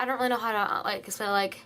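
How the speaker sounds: usual speaking voice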